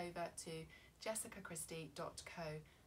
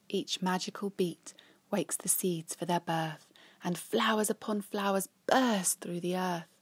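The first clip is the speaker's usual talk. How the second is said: lively read-aloud storytelling; reading a rhyming story to children; story narration; 17 dB louder than usual. A narrator's reading. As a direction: tell it like a bedtime picture book. Picture book read aloud.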